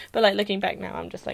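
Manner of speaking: usual speaking voice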